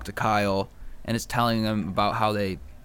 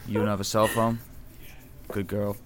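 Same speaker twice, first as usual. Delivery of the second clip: deadpan question, then a softer reply; Kyle; 2 semitones lower, 6 dB quieter than usual. Acted. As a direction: say it evenly, barely interested. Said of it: "your best Kyle impression".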